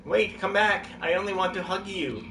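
high, pleading call; calling after someone. Real, not acted. Calls after fleeing gnome.

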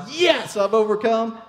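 high, loud mock triumph on the mountaintop; triumphant cheer. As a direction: crow about reaching the summit. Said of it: "You're like".

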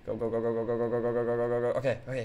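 ordinary talk, nothing marked

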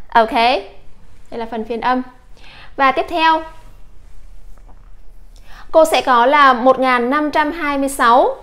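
normal talking voice

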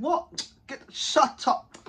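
hammed-up angry shout; retold angry reply. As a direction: throw back his words in disbelief. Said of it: "He said".

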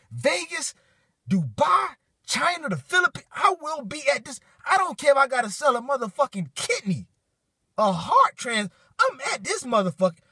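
a fan losing it over the big fight news; hyped up. Real, not acted. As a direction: pitch way up, words tumbling out in excitement.

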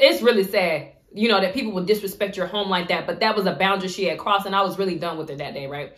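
ordinary talk, nothing marked

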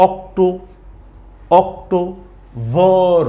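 October stretched very slowly, each syllable held; slowly drawn out. Shown as a demonstration. Teacher stretching October for learners. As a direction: draw out October like a spelling lesson.

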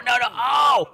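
loud panicked no no; alarmed shout. Real, not acted. Player shouting at his character slipping down. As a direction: yell it as you fall.

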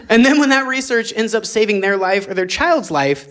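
usual speaking voice

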